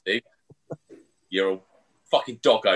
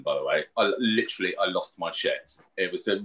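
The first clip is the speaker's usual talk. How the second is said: ordinary talk, nothing marked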